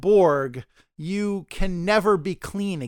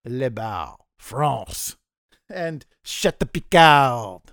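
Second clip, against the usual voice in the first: put-on French accent; 6 semitones lower, slower than usual. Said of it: "my French accent".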